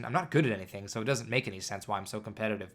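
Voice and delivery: ordinary talk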